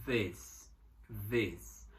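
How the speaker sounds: "this" with a short e vowel and a hissing S; this with an e sound. Shown as a demonstration. Teacher drills "this" with a short e and an S sound. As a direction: say "this" like "dess", short e. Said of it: "o i é pronunciado como se fosse um e".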